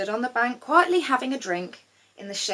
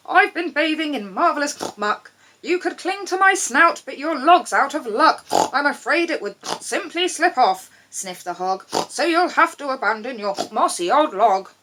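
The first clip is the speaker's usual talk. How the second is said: higher, louder hammed-up hog; a muck-loving hog, pleased with himself; lightly voiced jolly hog; 6 semitones higher, 6 dB louder than usual. A narrator's reading. Hog answers frog.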